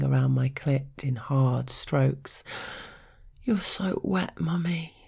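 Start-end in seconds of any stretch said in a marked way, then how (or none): 3.4-4.9 breathy whisper. A narrator's reading.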